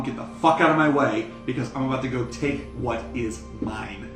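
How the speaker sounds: ordinary talk, nothing marked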